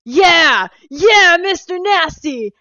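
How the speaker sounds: high, shouted hype-man impression; sudden excited yell, drawn out; imitating a loud pitch. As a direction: belt it out like an over-the-top salesman. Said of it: "he gets loud".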